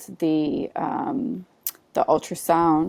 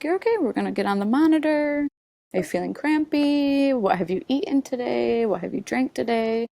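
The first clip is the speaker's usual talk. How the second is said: high, quick, gentle nurse voice running through a checklist; kind nurse; 6 semitones higher, faster than usual. Retold or imitated. Triage nurse checks in the pregnant patient, high and soothing. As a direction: reassure while asking questions fast. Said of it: "She's like".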